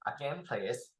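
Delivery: ordinary delivery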